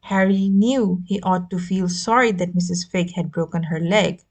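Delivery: plain reading voice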